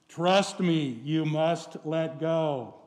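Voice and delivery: slow, calm divine reassurance; steady and slow, God insisting. Retold or imitated. God repeats command.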